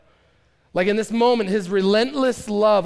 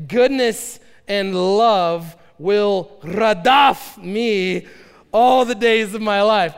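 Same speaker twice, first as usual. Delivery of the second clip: radaf drawn out slow and louder, stressed; read with radaf; 2 semitones higher, 6 dB louder, much slower than usual. Shown as a demonstration. Preacher rereading the verse with the Hebrew word, emphatic. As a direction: lean hard on radaf, then finish the verse loud. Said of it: "so let's do it again".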